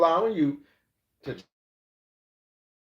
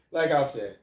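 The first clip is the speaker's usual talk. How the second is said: normal talking voice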